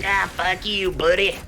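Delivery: high-pitched put-on jeering voice; cartoonish high-voiced ambush; mock demon taunt. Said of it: "or they're like".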